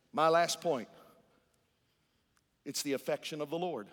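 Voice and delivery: ordinary talk, nothing marked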